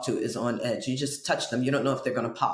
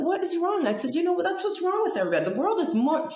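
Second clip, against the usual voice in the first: pitched up, fast lecturing; high preachy quote; 7 semitones higher than usual. Retold or imitated. Lecturing friend on the subway steps, high and fast.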